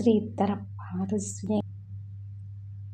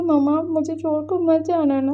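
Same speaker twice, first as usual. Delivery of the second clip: put-on pleading child; 7 semitones higher, 3 dB louder than usual. Child begging mother to stay.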